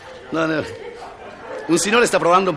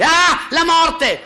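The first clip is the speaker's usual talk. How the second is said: very high, drawn-out cry of fright; hammed-up panic at seeing a skull; mock terrified shriek; 5 dB louder, much slower than usual. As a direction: shriek it in comic horror, loud and slow. Student startled by a skull.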